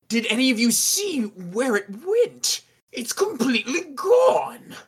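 high, theatrical wail of dismay; distraught rich host. Acted. Owner asks guests about his vanished stone, distraught.

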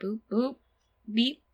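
high, soft little robot beeps; toy robot voice. Pretend. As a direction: beep like a tiny toy robot. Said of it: "Mbot, like that".

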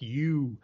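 "you" with an exaggerated open-mouthed vowel; over-enunciated "you". Shown as a demonstration. Showing how Rickman says it, open-mouthed "you". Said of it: "open-mouthed".

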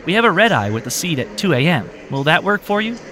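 cheerful clerk. Acted. Clerk offers red-eye.

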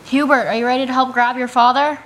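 calling out. Acted. Rescuer to the son outside the cabin, calling out. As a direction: call across to your helper outside. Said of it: "So I'll, I'll call out to you".

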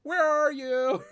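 hammed-up worried shout; mock alarmed shout. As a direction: call out in fake alarm. Shouting concern at hungover friend.

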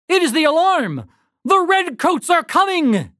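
high-pitched excited warning; urgent alarm. A narrator's reading. Woken farmers warning each other of the redcoats, alarmed.

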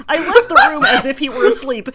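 reading it out through a burst of laughter; can barely get the words out for laughing; laughing while reading. Real, not acted. Reading a garbled translation to friends, laughing.

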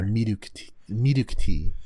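"milk tea" repeated as "miduk tee"; Japanese inflection. Shown as a demonstration. Host modelling it for friend, "milk tea" as "miduk tee". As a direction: demonstrate "milk tea" with tapped R replacing L. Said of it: "Japanese inflections".

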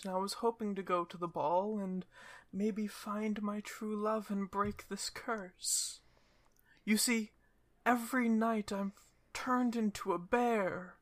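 high, gentle voice of a sad cursed princess; sad cursed princess bear. Acted. Bear-princess tells the party her sad story, in a high gentle voice.

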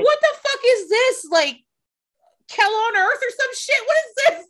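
new at a job, high mock-outraged disbelief; incredulous outrage. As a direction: squeal it like the world went mad. Retold outraged inner reaction.

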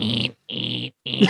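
low, mechanical repeated "eent"; imitated toy noise. It makes noise when it walks, imitated toy noise. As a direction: drone it like a walking robot toy. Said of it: "It makes noise when it walks".

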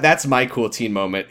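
ordinary delivery

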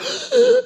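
sharp, high, loud shocked gasp; startled gasp. Retold or imitated. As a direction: gasp in sudden alarm. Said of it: "I was like".

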